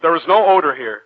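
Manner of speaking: desperate denial climbing to a shout; rising frantic denial. Acted. Giovanni denies in rising panic.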